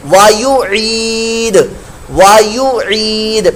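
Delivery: وایو عید drawn out very slowly, each syllable separate; slow spelled-out recitation; slow syllable drill. Shown as a demonstration. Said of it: "پڑھانے کی کوشش کر رہا ہوں".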